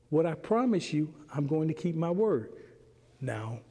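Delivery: ordinary talk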